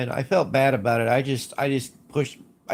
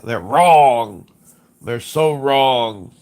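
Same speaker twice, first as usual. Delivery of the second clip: ranting shout; 8 dB louder, much slower than usual. Real, not acted. Ranting about pronunciation.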